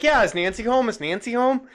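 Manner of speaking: a keen echo; hammed-up chirpy Laura; mock eager friend. Mocking Laura quote.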